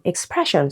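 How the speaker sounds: "expression" stressed on PRESS; second-syllable stress. Shown as a demonstration. Teacher moving on to a new example, second-syllable stress. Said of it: "second syllable".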